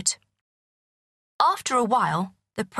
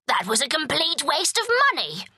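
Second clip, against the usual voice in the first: higher, put-on grumbling king; a hint of a huffy king; indignant king; 8 semitones higher than usual. A narrator's reading. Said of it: "said the king".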